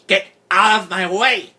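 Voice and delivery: high, loud, angry shriek; furious scream. A narrator's reading. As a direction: scream it to clear the way. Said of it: "Ferris screamed".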